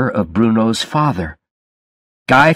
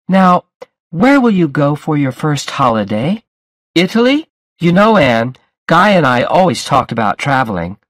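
high, over-familiar party guest; tipsy, chatty Bruno; 6 semitones higher than usual. A narrator's reading. Drunk guest to the bride about holidays, chatty. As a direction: gush at the bride in a higher voice.

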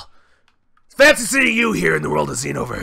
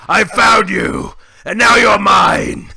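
slow, louder menacing yell; triumphant shout; 5 dB louder, slower than usual. Acted. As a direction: roar it as the hunter finds his prey. Broly hunting rival.

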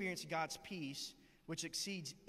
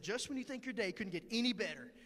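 upbeat, brightly thankful retelling; imitating an unshakably cheerful coworker; cheery acceptance; 5 semitones higher than usual. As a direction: make the mishap sound like a blessing. Repeating coworker's cheerful line.

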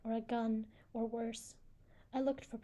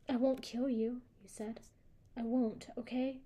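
plain reading voice

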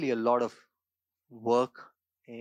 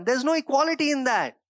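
emphatic exclamation; 12 semitones higher, 7 dB louder than usual. Acted. Climax of talents parable point.

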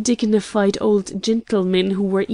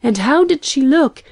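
high, louder eager question; keen, raised-pitch asking; eager curiosity; 6 semitones higher, 6 dB louder than usual. A narrator's reading. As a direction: press excitedly for the news. Excited friend asks for news.

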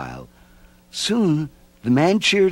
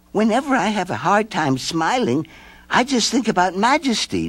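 put-on higher little girl, earnest; 10 semitones higher than usual. A narrator's reading. Nancy advising the gloomy mope, high girl voice. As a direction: share advice like a young girl.

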